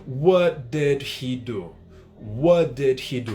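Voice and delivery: "What did he do?" word by word, slow; slow, every word of "What did he do" separated; textbook English. Shown as a demonstration. Teacher giving the example phrase, slow and fully separated. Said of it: "ele não vai falar".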